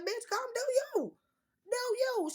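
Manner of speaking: high, loud mock-dismissive "do you"; dismissive high yell. Mocking friend's offer, loud high.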